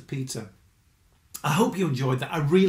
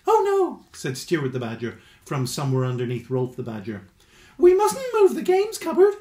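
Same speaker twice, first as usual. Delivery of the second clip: helpful Stuart; 5 dB louder than usual. Acted. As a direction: chirp it up brightly and helpfully. Stuart's squeaky badger voice.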